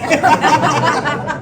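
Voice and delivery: hearty burst of laughter; real laugh. Real, not acted. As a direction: laugh out loud at the joke. Laughing at his own joke.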